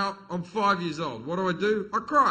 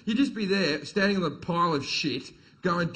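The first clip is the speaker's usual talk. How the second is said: ordinary talk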